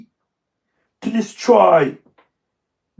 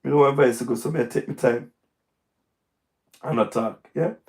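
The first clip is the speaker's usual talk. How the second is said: worn-out voice; 6 semitones lower, 5 dB quieter than usual. Real, not acted. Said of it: "you hear my voice".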